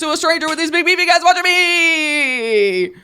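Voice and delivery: loud, high, mounting hysteria; comic panic. Retold or imitated. Comic reliving realising they're in a sting, mock-panicked shout. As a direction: wail it like the trap just closed.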